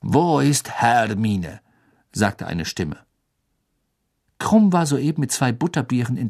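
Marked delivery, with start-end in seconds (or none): 0.0-1.6 put-on slow, foreign-accented voice; Krum's foreign accent. A narrator's reading. Krum asks Ron where Hermine is, foreign accent. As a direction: draw the words out in a heavy foreign accent.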